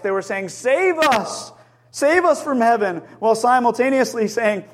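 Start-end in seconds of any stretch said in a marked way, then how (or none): 0.5-3.0 mock crowd cry, calling up for help; retold shout. Preacher voicing the crowd pleading to heaven, retold shout. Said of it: "When they shouted".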